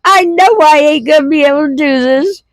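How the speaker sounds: high and laughing, barely holding it together; giggling, pitch way up, words cracking. Real, not acted. Mom laughing while retelling the drive-thru order. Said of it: "I can't even tell it without laughing".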